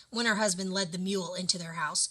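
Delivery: usual speaking voice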